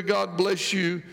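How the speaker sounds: ordinary talk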